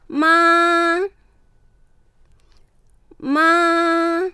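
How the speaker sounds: teacher demonstrating ま in first tone; Mandarin first tone. Shown as a demonstration. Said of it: "高い音をそのまま平らに伸ばして発音する".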